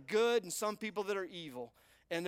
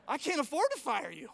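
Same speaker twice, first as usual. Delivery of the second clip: emphatic boss; 4 semitones higher, 3 dB louder than usual. Retold or imitated. Watson retorts to the executive.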